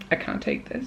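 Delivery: overwhelmed aside. Real, not acted. Dramatic reaction after requesting a song.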